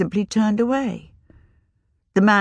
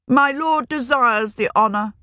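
put-on higher, proud young wizard; haughty young wizard; 6 semitones higher than usual. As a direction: lay claim with pride. Young wizard claims burial.

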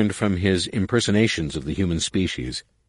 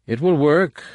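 simply; 11 semitones higher, 6 dB louder than usual. Acted. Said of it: "Nomanor said simply".

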